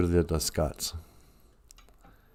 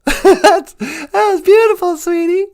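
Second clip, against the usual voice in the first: very high cooing voice; high pet voice. Acted. A squeaky cooing voice praising the host's intro. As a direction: coo it like baby talk.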